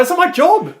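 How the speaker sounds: high, loud mock protest; exasperated retort. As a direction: squawk it in retold indignation. Retold exasperated reply to gym owner.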